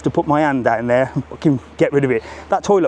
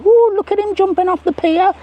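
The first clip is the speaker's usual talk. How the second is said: much higher, gushing granny voice; imitating his mother cooing over the grandson; proud grandmother; 3 dB louder than usual. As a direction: coo like a doting nan filming her grandson. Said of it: "like a proud grandmother".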